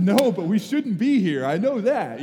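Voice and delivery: loud, high, exasperated comeback, acted out; panicked shouted reply. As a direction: shout back in comic panic. Retold panicked shouted reply at sea.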